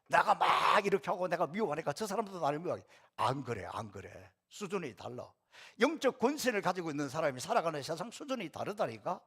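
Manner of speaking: ordinary talk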